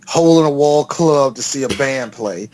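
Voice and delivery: usual speaking voice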